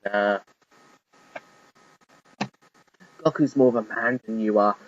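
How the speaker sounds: low, mocking put-down; derisive. A narrator's reading. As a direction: scoff at him from below. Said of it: "Greasel said derisively".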